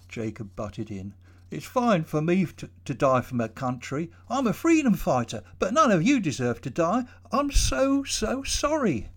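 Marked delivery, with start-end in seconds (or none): 1.5-8.0 much higher, louder, anguished apology; Jacob in remorse. Acted. Jacob tells the trapped workers they don't deserve to die, anguished and high. 8.0-9.1 slow, high, broken sorry; tearful apology. Acted. Jacob apologises to the trapped workers, slow and near tears.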